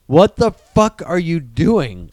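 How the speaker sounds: slow, loud exasperated rant; mock outrage. Host scolds the studio for overstuffing the movie, slow and loud. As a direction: drag out the disbelief.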